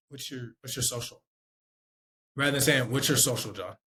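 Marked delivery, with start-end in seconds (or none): 0.1-1.2 soft, low, stumbling question; timid request for information; unsure ask. Shown as a demonstration. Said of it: "I sound way different saying". 2.9-3.8 direct, matter-of-fact question; firm, falling request; confident ask. Shown as a demonstration. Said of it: "I sound confident".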